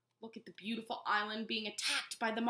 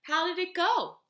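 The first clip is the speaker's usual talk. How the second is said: calling out; 10 semitones higher, 8 dB louder than usual. A narrator's reading. Mother calls out to Lola.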